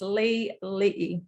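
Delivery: Lee Lee sounded out slowly in separate syllables; careful, spaced-out Lee Lee; proper surname pronunciation. Shown as a demonstration. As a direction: teach Lee Lee slowly, part by part. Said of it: "so it's pronounced".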